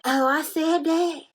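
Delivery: high, slow feigned surprise; mock innocence. Storyteller imitating the friend caught out, mock innocent.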